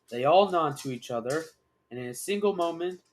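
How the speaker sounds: plain reading voice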